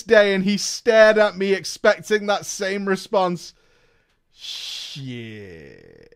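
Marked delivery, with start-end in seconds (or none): 4.3-6.1 muttered dread. Real, not acted. Dread at the stare.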